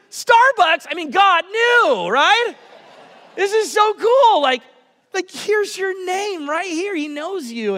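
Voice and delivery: thrilled outburst with laughter; excited. Retold or imitated. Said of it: "I was like".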